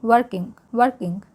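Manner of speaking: working said with the r almost silent; working with a faint, nearly silent r; soft r in working. Shown as a demonstration. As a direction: say working, working barely touching the r. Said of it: "आर को बिल्कुल हल्की सी silently".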